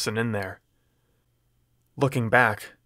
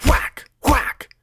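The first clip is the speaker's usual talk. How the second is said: whacks; 5 dB louder than usual. A narrator's reading. Blows voiced in fight.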